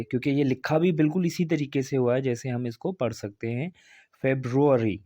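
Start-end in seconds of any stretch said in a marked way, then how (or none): 4.2-5.0 फेब्रुअरी said febrori, r rolled in; teacher models फेब्रुअरी as feb-ro-ri; correct February. Shown as a demonstration. Teacher to students, modelling फेब्रुअरी as febrori. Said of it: "इसका pronunciation भी यही है".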